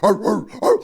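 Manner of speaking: excited mock barking; playful "arr-arr" dog yaps; imitated dog bark.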